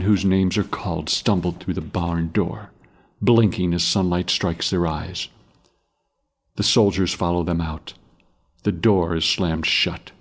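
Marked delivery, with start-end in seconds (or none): none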